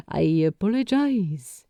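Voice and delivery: gently calm him down; gentle. A narrator's reading. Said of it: "he said gently".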